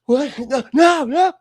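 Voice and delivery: high, gasping disbelief; gasp, then loud high-pitched refusals; hammed-up shocked outburst; alarmed protest. Retold Peter panics at arrest.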